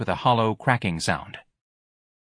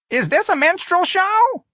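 very high, slow, loud squawk; old woman's cry; 5 dB louder, slower than usual. Acted. Miss Trixie bewildered by the singing choir, crying out. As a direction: call out high, stretching the words. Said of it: "Miss Trixie cried".

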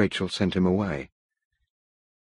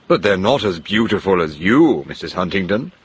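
a passionate exclamation, louder at the start; cried out; 5 dB louder than usual. A narrator's reading. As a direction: call it out to her with ardour. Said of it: "Mister Hargreave cried".

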